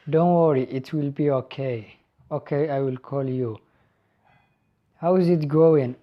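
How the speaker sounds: ordinary talk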